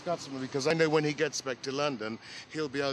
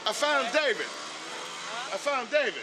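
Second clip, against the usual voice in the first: excited greeting; 7 semitones higher, 3 dB louder than usual. Real, not acted. Greeting old friends, excited.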